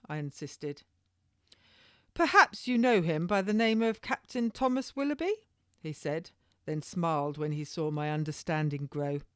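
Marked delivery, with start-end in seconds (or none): 2.1-5.3 higher, knowing reveal; spring the trap; sly officer. A narrator's reading. Waller springs the name.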